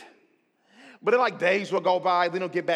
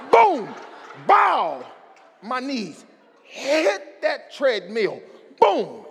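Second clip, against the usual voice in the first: loud imitated boom; crash sound; 4 semitones higher, 4 dB louder, much slower than usual. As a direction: slam it like an impact. Knees crash on treadmill.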